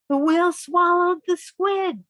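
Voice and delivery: loud, high burst of surprise; dramatic exclamation. Acted. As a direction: announce the big gulp with gusto. Whale swallows the squid, dramatic.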